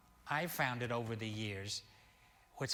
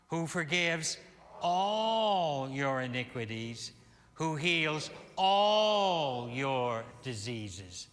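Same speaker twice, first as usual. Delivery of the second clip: "all" drawn out and louder, slow; emphasis on all; 4 dB louder, slower than usual. Shown as a demonstration. Minister stressing "all" for the congregation. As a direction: lean on "all" in "all your iniquities". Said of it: "Put the emphasis in the right places".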